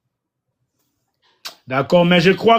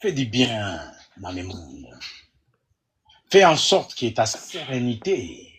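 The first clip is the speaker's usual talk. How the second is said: prayer; 8 semitones lower than usual. Real, not acted. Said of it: "Je vais juste prier pour tout le monde".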